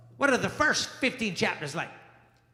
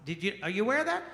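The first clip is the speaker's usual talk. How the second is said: ordinary talk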